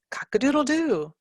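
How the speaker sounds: high sing-song cock-a-doodle-doo; rooster crow. Shown as a demonstration. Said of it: "and the child says".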